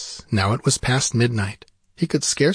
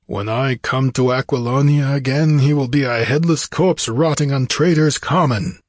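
emphatic warrior's vow, pitch raised; vengeful warrior king; 4 semitones higher, 4 dB louder than usual. Acted. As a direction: threaten the traitor like a wronged king. Conan vows revenge.

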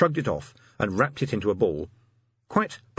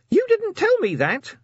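imp voice; 5 dB louder than usual. A narrator's reading.

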